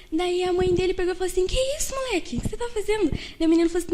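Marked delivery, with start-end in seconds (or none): 1.5-3.1 surprised scolding mother. Retold or imitated.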